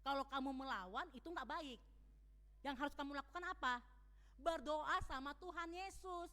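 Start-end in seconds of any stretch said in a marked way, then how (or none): none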